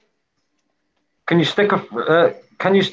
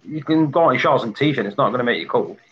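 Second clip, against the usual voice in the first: ordinary delivery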